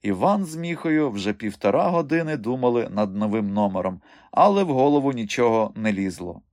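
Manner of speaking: usual speaking voice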